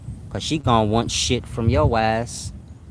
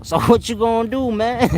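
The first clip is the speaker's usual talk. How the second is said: higher put-on woman voice, scoffing; mocking girlfriend voice; 10 semitones higher than usual. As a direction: throw the question at him like a bored girlfriend. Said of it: "singular talk".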